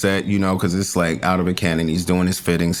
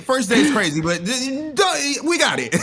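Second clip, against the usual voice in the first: laughing. Retold or imitated. Reassuring nervous co-star, laughing.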